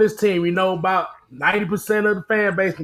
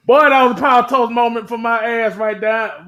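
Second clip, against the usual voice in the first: ordinary talk, nothing marked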